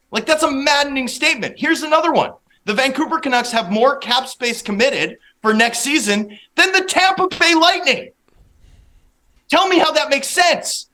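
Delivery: high-pitched, incredulous challenge; exasperated rant. Real, not acted. Analyst attacking a maddening trade, exasperated and incredulous. Guest ranting about a bad trade.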